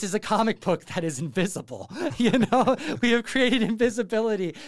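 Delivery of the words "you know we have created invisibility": high, louder, laughing through a mock sales line; chuckling mock salesman; laughing mock pitch. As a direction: giggle while hamming up the pitch. Mocking Dinesh's pitch, laughing.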